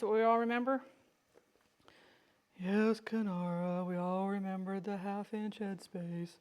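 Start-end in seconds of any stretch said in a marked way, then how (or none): 2.6-6.3 slow, droning pupils answering together; mock class chorus. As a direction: drag it out like a bored class.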